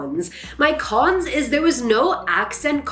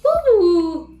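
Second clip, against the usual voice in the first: cheer; 8 semitones higher, 4 dB louder than usual. Real, not acted. Cheer before wrapping up.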